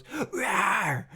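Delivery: put-on a kid's tiger growl, rawr; mock tiger roar. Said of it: "and she goes".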